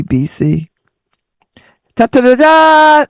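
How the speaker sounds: ordinary talk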